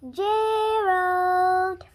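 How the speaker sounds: loud call across the distance. Acted. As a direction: call his name out loud. Piggie calls out to Gerald.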